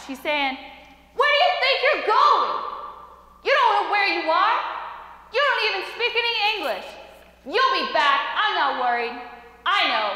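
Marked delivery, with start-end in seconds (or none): 1.2-10.1 high, loud mother's yell; angry yelling. Retold or imitated. Mother to son storming out the door, still yelling. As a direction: yell after someone, acted out at high pitch. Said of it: "she's still yelling at me".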